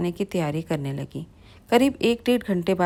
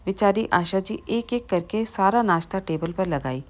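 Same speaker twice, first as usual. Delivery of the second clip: ordinary delivery, nothing marked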